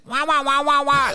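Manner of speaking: trumpet-like wah wah, Peanuts adult; Snoopy wah-wah. Retold or imitated. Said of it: "like Snoopy".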